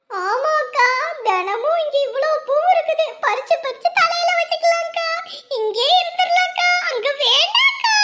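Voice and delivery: put-on high, eager child's plea; excited pleading. Child begging her big sister to stay with the flowers, excitedly. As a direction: bubble over with excitement.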